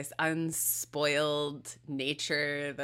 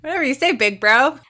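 put-on high, loud teasing kid-sister voice; a cheeky little sister humoring her brother; mock sister reply; 8 semitones higher, 12 dB louder, faster than usual. Sister teasing her big brother, high and loud.

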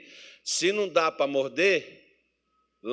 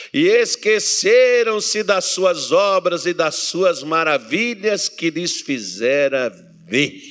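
loud, very slow, stretched declamation; preacher's scripture reading; 8 dB louder, much slower than usual. Real, not acted. Preacher reading the Psalm to the church, slowly declaiming. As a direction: proclaim each phrase at full force, holding the endings. Reads verse aloud at full force.

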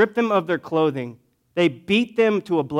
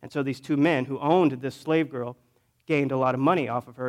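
ordinary talk, nothing marked

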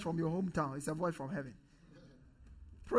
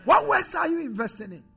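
high, louder challenging question; preaching challenge; 7 semitones higher, 5 dB louder than usual. Real, not acted. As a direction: demand an answer from the pews. Repeated question to congregation.

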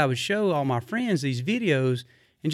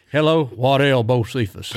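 louder imitation of a famous country singer's phone greeting; 7 dB louder than usual. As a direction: say hello like the star himself is on the line. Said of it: "caller I D first come out and like".

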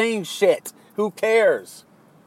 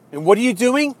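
ordinary talk, nothing marked

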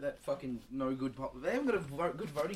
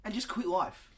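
blunt address; 6 semitones higher, 5 dB louder than usual. Pretend. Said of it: "This is Australian just telling [name]".

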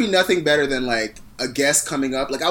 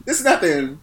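ordinary talk, nothing marked

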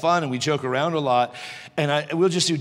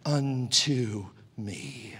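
hushed sermon close; 5 dB quieter, much slower than usual. Real, not acted. Preacher closing on God's grace to him, hushed. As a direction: drop suddenly to a slow, reverent hush.

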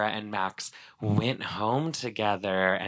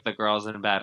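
ordinary talk, nothing marked

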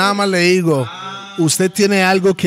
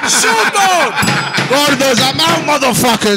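laughing sign-off; 5 semitones higher, slower than usual. Acted.

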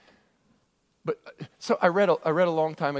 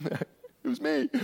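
laughing aside; 11 semitones higher, 4 dB quieter, much slower than usual. Acted. Aside during David's taunt.

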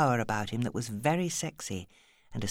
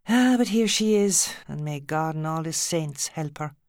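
raised Irish lilt, louder and higher; Irish exclamation; 4 semitones higher, 5 dB louder than usual. A narrator's reading. Alice seeing Maddie walk in, raised Irish lilt. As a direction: throw up her hands in Irish dismay.

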